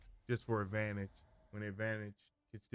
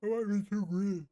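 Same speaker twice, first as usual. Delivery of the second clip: yawning; 8 semitones higher, 4 dB louder than usual. Real, not acted. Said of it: "I'm sorry for the yawning".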